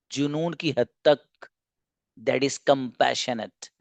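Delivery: slowed down, spelling out जुनून की हद तक; slow emphasis. Real, not acted. Teacher driving home what compassionate means to students. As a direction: lay each word down deliberately. Teacher slows to stress the definition.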